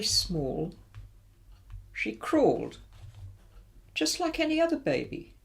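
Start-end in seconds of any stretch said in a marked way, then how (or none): none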